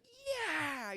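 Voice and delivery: high, drawn-out cheer; imitated scream. Costumed guy cheering at the friends, high yell. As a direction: act out his ecstatic "yeah". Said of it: "screams and puts his arms out".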